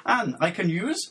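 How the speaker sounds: "Anne, I can use" rising sharply on "use"; upward question inflection. Shown as a demonstration. Host showing co-host the question mark, "use" swung upward. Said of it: "giving it an upward inflection".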